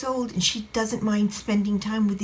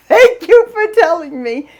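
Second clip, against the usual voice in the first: laughing thanks; 9 semitones higher, 10 dB louder than usual. Retold or imitated. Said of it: "started laughing hysterically and said".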